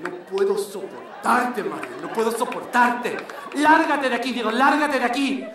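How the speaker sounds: overacted soap-opera melodrama; hammed-up telenovela fight; telenovela drama. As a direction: play it like a cheesy telenovela. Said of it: "Spanish novel".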